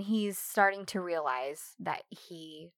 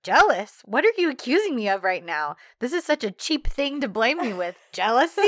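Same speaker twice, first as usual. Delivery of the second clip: louder, indignant mock denial; hammed-up offended shout; mock outrage; 3 semitones higher, 7 dB louder than usual. Imitating John denying jealousy, mock outrage.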